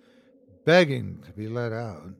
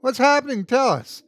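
high, loud urgent demand; 10 semitones higher, 5 dB louder than usual. A narrator's reading. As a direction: insist on an answer right now. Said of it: "Will demanded".

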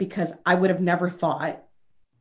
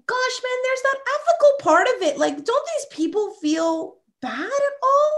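indignant disbelief; 14 semitones higher than usual. Real, not acted. Host asks why phone scammers feel no guilt, in high-pitched disbelief. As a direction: ask it in stunned, high-pitched outrage. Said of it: "indignant about scammers".

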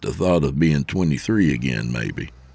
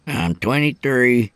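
higher, put-on young man's voice, unamused; flat-voiced young trainee; 7 semitones higher than usual. Trainee correcting the older truckers, flat-voiced. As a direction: state it as the unimpressed kid. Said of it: "his voice flat".

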